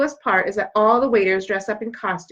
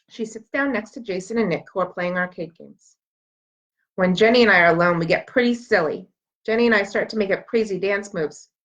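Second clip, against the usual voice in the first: ordinary delivery, nothing marked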